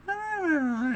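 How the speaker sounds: mock squeal. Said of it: "you can hear her go".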